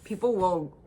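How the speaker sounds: ordinary talk, nothing marked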